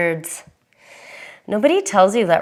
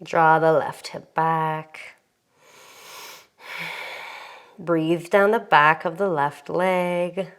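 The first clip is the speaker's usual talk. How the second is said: calm yoga instructor. Acted.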